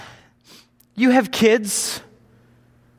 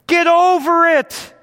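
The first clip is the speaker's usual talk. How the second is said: louder blunt command; 6 semitones higher, 3 dB louder than usual. Real, not acted. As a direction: Get over it, higher and louder. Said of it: "repeated louder".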